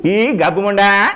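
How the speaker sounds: high, slow character-actor voice. Acted. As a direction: drawl the lyrics and end on mock abuse. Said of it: "మన JP గారు".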